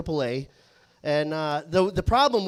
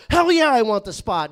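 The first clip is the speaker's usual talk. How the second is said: loud, higher burst of enthusiasm; eager customer; 5 semitones higher, 8 dB louder than usual. Retold or imitated. Caller grabbing the four o'clock appointment, loud and eager. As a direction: jump at the offer.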